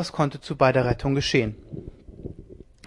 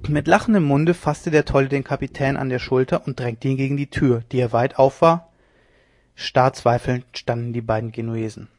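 usual speaking voice

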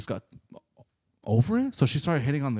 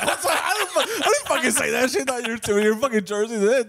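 high, louder, laughing through the words; laughing protest; 11 semitones higher, 5 dB louder than usual. Real, not acted. Laughing and denying the friend's joke, high and loud. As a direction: protest while cracking up.

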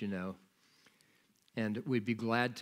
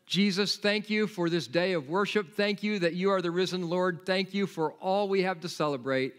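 earnest, louder prayer of thanks; closing prayer; 3 semitones higher, 3 dB louder than usual. Acted. Pastor praying for his church, earnest prayer voice. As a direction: pour out thanks to God.

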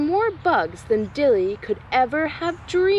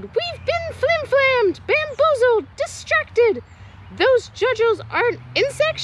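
yelled alarm; 13 semitones higher than usual. Acted. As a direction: sound the alarm to the crowd. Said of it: "She yelled".

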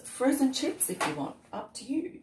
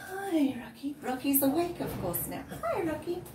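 pet greeting voice; 6 semitones higher than usual. Real, not acted.

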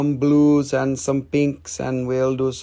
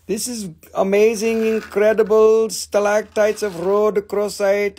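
mock amazed collector; excited discoverer; 6 semitones higher than usual. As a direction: gush like someone finding treasure. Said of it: "somebody came and they said".

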